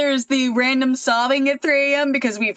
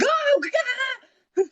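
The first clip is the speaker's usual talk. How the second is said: startled gasp. Retold or imitated. Startled by husband while driving.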